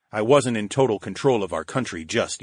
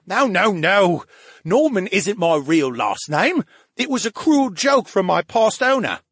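high, loud, complaining sidekick voice; a needy brain in a tank griping at his friend; whiny brain voice; 8 semitones higher, 3 dB louder than usual. Acted. AB explains.

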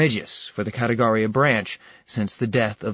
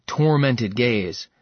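usual speaking voice

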